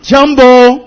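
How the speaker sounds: loud, high "Jumbo"; shouted greeting. Shown as a demonstration. Preacher calling Jumbo to the congregation, loud. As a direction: call it out to the hall. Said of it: "我再来一次".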